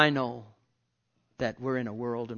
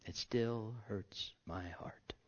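slow, whispered, unvoiced; quietly hurt, whispered slowly; 3 semitones lower, 12 dB quieter, much slower than usual. Real, not acted. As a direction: let it fall to a hurt whisper.

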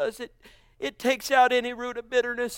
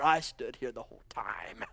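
lower, whispered sulky complaint; self-righteous whisper; 13 semitones lower, 6 dB quieter than usual. Acted. Elder brother complaining to father, whispered. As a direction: hiss the grievance under your breath. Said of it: "self-righteous there".

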